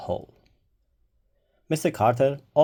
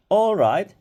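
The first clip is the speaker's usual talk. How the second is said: brisk call; 7 semitones higher, 9 dB louder than usual. A narrator's reading. Starting the class activity.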